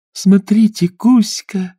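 high excited mermaid call; shout. Acted. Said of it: "закричала она". (радостный крик)

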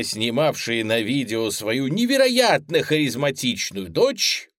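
usual speaking voice

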